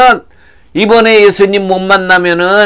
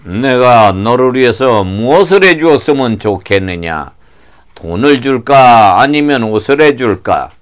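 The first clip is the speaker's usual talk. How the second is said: low, composed questioning; calm Jesus; 5 semitones lower than usual. Acted. Jesus asking the blind man his wish, calm and low. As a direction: ask kindly in a deep voice.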